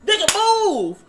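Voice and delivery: imitating Sora. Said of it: "'cause he's like".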